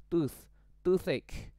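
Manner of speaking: Tooth, toothache slow, with a clear th made on the tongue; th sound demo. Shown as a demonstration. Teacher modelling the th sound for pupils, slowly. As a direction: stretch out Tooth, toothache so the th can be heard. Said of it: "tooth itu suaranya kayak th gitu ya".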